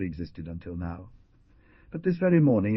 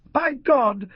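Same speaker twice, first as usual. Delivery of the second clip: much higher, loud cry of fury; shrill exclamation; 8 dB louder than usual. A narrator's reading. Jaguar springs up with his rifle on hearing the news, shrill furious cry. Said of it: "exclaimed with shrill voice".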